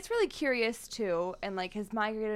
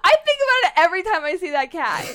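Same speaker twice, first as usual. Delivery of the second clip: loud, high, bursting with amusement; gleeful insisting; 11 semitones higher, 12 dB louder than usual. Real, not acted. Co-host insists on telling everybody the cat story, gleeful. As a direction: belt it out high, tickled by the story.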